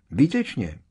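self-important, high-pitched delivery; Percy. A narrator's reading. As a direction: pronounce it eagerly with great importance. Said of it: "tvářil se patřičně důležitě". (vysoký, důležitý tón)

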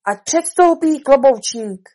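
slow, loud, high summons; calling out. Acted. Rabbit summons the hatter to testify, calling out. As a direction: summon the witness across the courtroom. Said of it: "bílý králík zvolal".

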